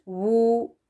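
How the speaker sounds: "वू" slow with lips rounded. Shown as a demonstration. Teacher isolating the W sound slowly. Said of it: "होंठों को गोल रखें".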